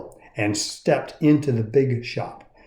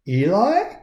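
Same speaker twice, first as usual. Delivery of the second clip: high, puzzled question; a small puppet wondering; lightly voiced little puppet; curious Punchinella; 7 semitones higher than usual. A narrator's reading. Punchinella asks.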